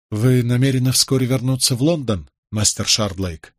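usual speaking voice

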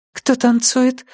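put-on high girlish asking back; child voice. A narrator's reading. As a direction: repeat it as a small girl. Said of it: "переспросила она".

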